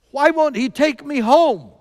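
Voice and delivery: put-on loud, high plea in a hinted German accent. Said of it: "in her full German accent".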